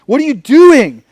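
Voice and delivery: startled officer exclaiming at the suspect; imitating the cop. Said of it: "He was just like".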